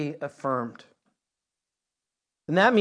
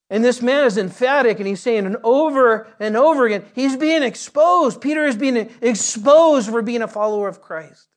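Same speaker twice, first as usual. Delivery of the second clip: loud, high, driving sermon voice; rising preacher's fervour; preaching at full force; 6 semitones higher, 6 dB louder than usual. Acted. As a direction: build it up and hammer the point. Preaching climax about exposure.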